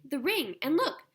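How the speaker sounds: high, loud eager exclaiming; excited cry. Acted. Diggory shows the girl their matching rings, excited. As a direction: point it all out in a rush of excitement. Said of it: "cried Diggory".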